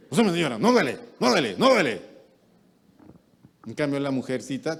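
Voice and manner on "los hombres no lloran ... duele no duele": louder, higher toughening bark; a father telling his son it doesn't hurt; tough-love shout. Retold or imitated. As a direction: push him to toughen up. Hardening a grown boy.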